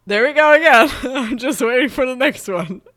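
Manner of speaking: loud, high, giggly outburst; laughing excitedly. Real, not acted. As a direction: burst out laughing at the hiccup. Hiccups amuse the speaker.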